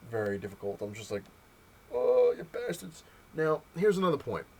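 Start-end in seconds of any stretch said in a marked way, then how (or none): 1.9-3.0 much higher, louder, slow mock cry of outrage. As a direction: wail it at the publisher, hammed up. Cursing Marvel for price hike.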